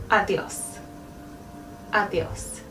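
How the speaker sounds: Adios with a soft D, ah-dthee-os; tongue under the teeth for Adios; Spanish adios. Shown as a demonstration. Teacher modeling goodbye, soft Spanish D. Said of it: "Goodbye is adios".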